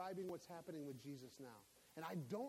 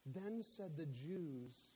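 ordinary talk

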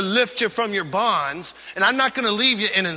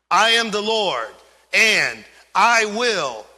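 higher and louder, "and I will" stretched very slowly; emphatic proclamation; 4 semitones higher, 4 dB louder, slower than usual. Shown as a demonstration. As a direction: declare it, then draw out "I will". Reading God's promise.

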